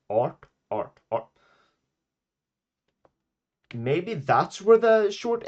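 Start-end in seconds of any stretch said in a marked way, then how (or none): 0.1-1.3 art repeated with retroflex t; Swedish art. Shown as a demonstration. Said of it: "we say art".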